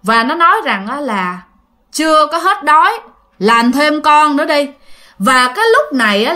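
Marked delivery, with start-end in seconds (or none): 1.9-4.7 put-on the possessed boy growls for more chicken in a man's voice. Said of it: "cái giọng của nó rất là kỳ". (giọng đàn ông trung niên ma nhập, to hơn)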